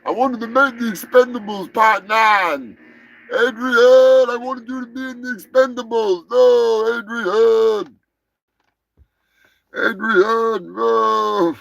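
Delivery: hammed-up boxer bawling for his wife; long wailed name, then a complaint; Rocky Balboa. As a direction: play a dead prizefighter calling down mournfully. Rocky impression continues.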